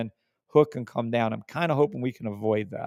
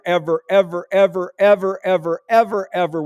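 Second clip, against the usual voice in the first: each ever hammered, building louder; emphatic ever; 5 semitones higher, 5 dB louder than usual. Real, not acted. Vowing to viewers it never repaints, building emphatic. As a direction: swear it on every ever.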